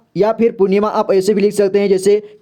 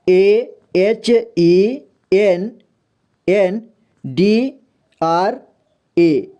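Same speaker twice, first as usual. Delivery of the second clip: letters stretched out very slowly; slow spelling; much slower than usual. Shown as a demonstration. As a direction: spell it out for the class. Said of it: "देखिए M". (धीरे-धीरे अक्षर बताना)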